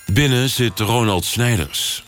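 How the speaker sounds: usual speaking voice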